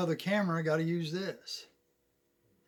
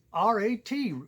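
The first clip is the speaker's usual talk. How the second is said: high, loud spelling from the country buddy; hammed-up, high-pitched good ol' boy; gas station buddy; 8 semitones higher, 6 dB louder than usual. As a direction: spell it out proudly as the hometown pal. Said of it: "his buddy stays and works at the gas".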